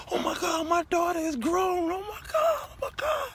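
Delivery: high, loud panicked shout; freaked-out cry. Retold or imitated. Father realizes his daughter is grown, shouting high in panic. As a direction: cry out in shock. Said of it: "I went".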